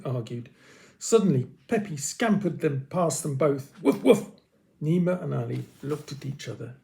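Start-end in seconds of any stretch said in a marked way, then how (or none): 3.8-4.3 high yappy woof woof; playful puppy bark; dog barking. A narrator's reading. Dog barks in story.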